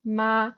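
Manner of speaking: "Ma" held flat on one steady pitch; level tone. Shown as a demonstration. Explaining Mandarin tones to a curious interviewer, "Ma" level and flat. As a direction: say "Ma" with the voice staying level, neutral tone. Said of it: "So you can just say, for example".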